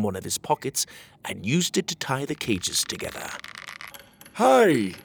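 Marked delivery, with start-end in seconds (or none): 4.3-4.9 high, loud yell of a guard. Acted. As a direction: bark a warning across the dungeon. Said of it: "yelled one of the porcupine guards".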